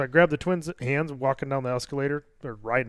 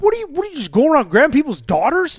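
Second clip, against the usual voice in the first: higher, louder furious accusation; angry yell; 11 semitones higher, 7 dB louder than usual. Retold or imitated. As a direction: confront the stranger at full volume. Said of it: "I'm screaming at this guy".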